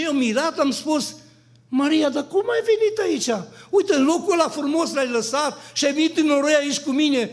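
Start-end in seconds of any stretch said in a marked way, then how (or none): 1.7-7.3 high, loud amazed question; astonished call. Retold or imitated. Man in his dream calls out astonished to Maria. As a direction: cry out in wonder at seeing her.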